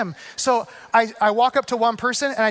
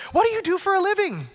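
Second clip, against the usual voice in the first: bright curious question; 9 semitones higher than usual. Retold or imitated. Lady asks a question.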